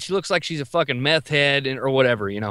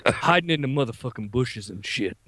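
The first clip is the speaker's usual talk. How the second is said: put-on low, understated old-timer voice; Vietnam veteran; 7 semitones lower, 4 dB quieter than usual. Veteran turning to the customer behind him, low rattled confession. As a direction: confide it low, phrase by phrase. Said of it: "turns around to me and goes".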